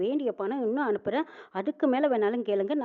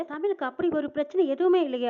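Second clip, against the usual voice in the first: shocked disbelief; 6 semitones higher, 3 dB louder than usual. Retold or imitated. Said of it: "அதிர்ச்சி அடைறாங்க".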